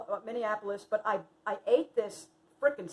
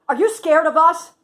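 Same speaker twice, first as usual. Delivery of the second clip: teasing; 6 semitones higher, 14 dB louder than usual. Retold or imitated. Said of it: "she said".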